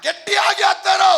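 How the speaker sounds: high, loud rallying sermon delivery; full-force preaching. Acted. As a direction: proclaim it to a packed congregation at full voice. (ಪೂರ್ಣ ಶಕ್ತಿಯ ಜೋರಾದ ಪ್ರವಚನ)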